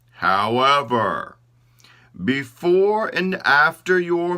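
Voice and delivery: high, loud, slow solemn pronouncement; God's decree. Acted. God instructing the body parts to fast, slow and solemn. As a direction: hand down the ruling slowly and grandly. God gives his decision.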